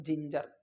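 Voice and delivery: जिंजर said low with j, like ginger; soft g. Shown as a demonstration. Teacher drilling the right word: soft j. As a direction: say जिंजर with two j sounds. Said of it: "गिंगर नहीं बोल करके".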